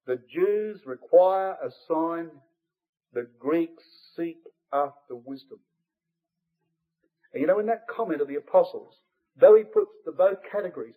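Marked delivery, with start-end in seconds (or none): 0.0-5.6 very slow, each word weighed, stress on require; slow deliberate quote. Shown as a demonstration. Preacher quoting Paul to the congregation, very slow and deliberate. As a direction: spell out Paul's words slowly so they sink in. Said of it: "Listen carefully".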